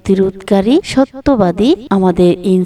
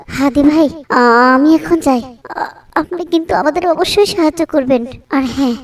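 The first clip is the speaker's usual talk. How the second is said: higher-pitched woman's voice, still pleading; anxious wife; 5 semitones higher than usual. Acted. Wronged wife asks Hadi for help on leaving, pleading. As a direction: press him hesitantly for a promise of help.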